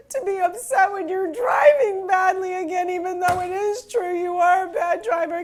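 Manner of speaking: high, loud, tearful wail breaking into laughter; crying and laughing. Retold or imitated. Confessing to husband she can't criticize his driving, crying loudly. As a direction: wail it through tears, then crack up. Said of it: "as I'm crying".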